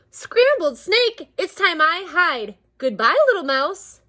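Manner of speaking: high, loud gasp easing down into a hasty goodbye; hammed-up alarm. Snake fleeing from the mouse, high mock alarm. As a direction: play a startled storybook snake.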